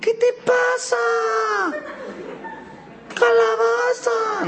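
very high, drawn-out squawk; hammed-up high-pitched tantrum; mock angry outburst. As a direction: screech it in comic outrage. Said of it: "los que están enojados no bromean".

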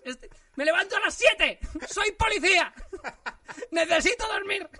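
high, loud exasperated yelling with laughs; mock outraged shouting dad; furious father yelling. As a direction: scream at a daughter who won't stop. Imagined angry father yelling.

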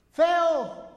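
shouted high and loud; high angry shout of "¡Feo!"; child's shout. Retold or imitated. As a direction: yell it like a cross kid into a cave. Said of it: "el niño gritó".

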